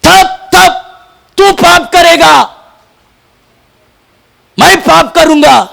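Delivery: high, loud, stretched preacher's cry; full-force preaching. Acted. Preacher rousing the church about sin, full force. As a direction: thunder it at the congregation. Preaching God's covenant with Phinehas.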